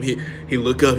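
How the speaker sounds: ordinary delivery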